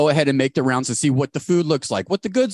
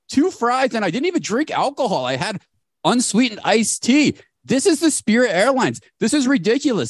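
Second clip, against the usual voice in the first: indignant rant; 8 semitones higher than usual. Acted. Comedian complains about paying for party food, mock indignant. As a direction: rant like you've been robbed, hammed up.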